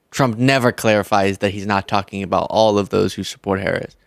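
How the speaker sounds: ordinary delivery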